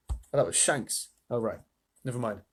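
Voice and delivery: usual speaking voice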